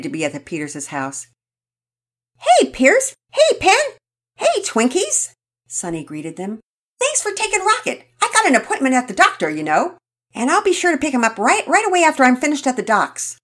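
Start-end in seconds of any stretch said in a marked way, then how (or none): 2.4-5.3 high, loud happy hellos; excited greeting. Acted. Sonny greets the children at the door, excitedly. 7.0-13.4 higher, louder, quick thanks; rushed mother. Acted. Sonny thanks the family while rushing to the doctor, hurried.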